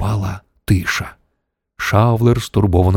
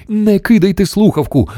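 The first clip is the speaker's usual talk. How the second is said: high-pitched urgent plea; exclaiming; 12 semitones higher than usual. A narrator's reading. Shanks begs Mission Control to stay on the line, exclaiming. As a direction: call out urgently. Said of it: "вигукнув Шанкс".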